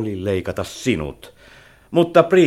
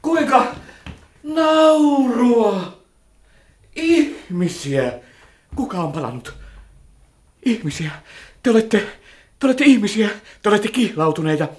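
loud amazed exclamations, higher; astonished; 12 semitones higher, 6 dB louder than usual. Acted. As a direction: cry out in disbelief. Old man discovers human feelings. (hämmästynyt huudahdus)

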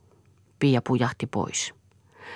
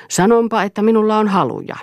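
higher, louder, heated retort; raised voice in a quarrel; defiant outburst; 9 semitones higher, 5 dB louder than usual. A narrator's reading. Acted quarrel, defiant declaration.

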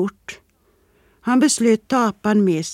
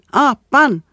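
loud, higher jeering shout; kids shouting; 6 semitones higher, 7 dB louder than usual. A narrator's reading. Street kids jeering at the sculptor, loud shout. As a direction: yell it like taunting street kids. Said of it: "satte de fart och skrek".

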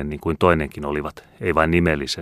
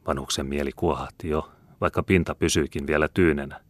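ordinary delivery

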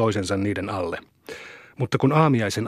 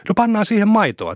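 higher-pitched eager suggestion; eager hurrying; 10 semitones higher, 3 dB louder than usual. A narrator's reading. Boy urging his friends to fill the can with milk, eagerly hurrying. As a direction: jump in with a high, keen start. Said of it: "Saku kiirehti".

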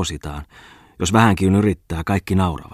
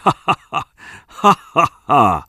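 high, loud "ha ha" cackle; loud mocking laugh; 8 semitones higher, 6 dB louder, slower than usual. Bungman mocks the editor's language error with high laughter.

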